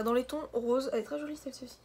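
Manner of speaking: ordinary delivery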